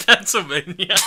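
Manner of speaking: laughing through the word, high and loud. Real, not acted. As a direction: burst out laughing on it. Cracks up during word game.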